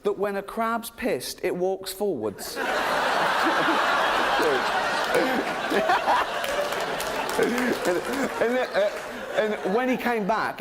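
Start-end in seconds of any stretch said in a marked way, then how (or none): 2.6-7.4 high, breaking fit of laughter; real cracking-up, high wheezy bursts; helpless laughter. Real, not acted. Comedian overcome by his own crab story, helpless laughter.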